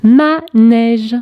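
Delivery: "Manège" slowly split as ma, nège; syllable by syllable. Shown as a demonstration. Teacher correcting a worksheet, syllable by syllable. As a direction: sound out "Manège" in two held beats.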